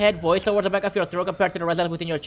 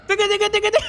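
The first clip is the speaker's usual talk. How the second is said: mock beat scat; 9 semitones higher, much faster than usual. Mimicking the song beat.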